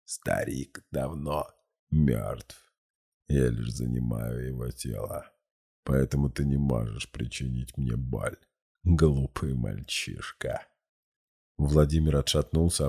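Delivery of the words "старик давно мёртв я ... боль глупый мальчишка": lower, soft, slow demonic voice; voice from the abyss. A narrator's reading. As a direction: speak from deep in the abyss. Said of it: "Гласом из бездны он произнёс".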